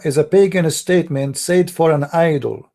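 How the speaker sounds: normal talking voice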